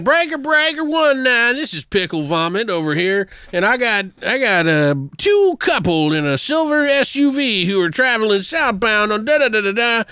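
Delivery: high-pitched trucker calling over the CB radio; mock trucker on the CB. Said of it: "he's like".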